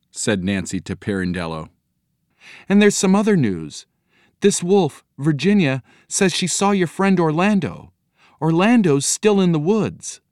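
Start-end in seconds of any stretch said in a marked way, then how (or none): 2.7-10.2 put-on high-pitched girl passing on news; young girl, eager. A narrator's reading. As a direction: relay it eagerly in a high child voice. Voices Nancy relaying more news.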